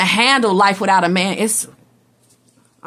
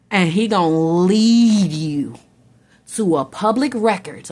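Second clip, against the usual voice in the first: usual speaking voice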